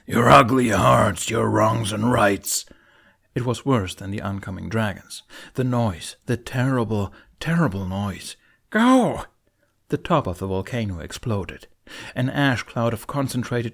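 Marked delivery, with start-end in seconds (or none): 0.1-2.6 slow, much louder looming monster intonation; ominous demon voice. A narrator's reading. Demon threatening the humans, slow booming intonation. 8.7-9.3 much higher and louder single cry; urgent shout. A narrator's reading. Hiccup ordering the twins away, urgent shout.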